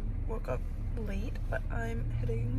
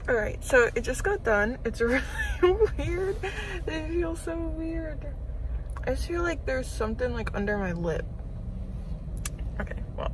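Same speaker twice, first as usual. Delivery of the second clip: muffled by cloth mask; speaking behind fabric covering; through a face mask; 4 semitones higher, 4 dB louder than usual. Real, not acted. Seen 0: Mask covers mouth and nose throughout; pulled down by 9.4.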